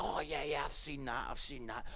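low, soft know-it-all murmur, lightly acted; know-it-all audience member. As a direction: play someone who has seen it all, low and quiet. Audience member knowingly claims to have seen it.

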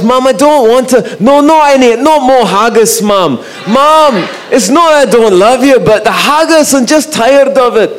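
put-on high-pitched, pleading Scottish accent. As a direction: imitate a Scottish son protesting to his mum. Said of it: "he'd put on the Scottish accent".

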